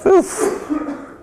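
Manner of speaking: breathless high oof of mock exhaustion; mock weary oof. Teacher after a long list of endings, mock weary oof. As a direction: blow out a high, tired oof.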